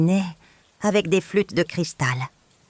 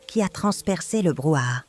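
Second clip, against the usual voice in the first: ordinary delivery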